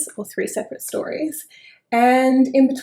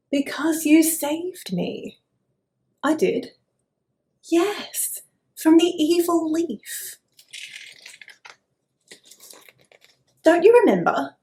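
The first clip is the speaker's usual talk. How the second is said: eager worm; 7 semitones higher, 4 dB louder than usual. Acted.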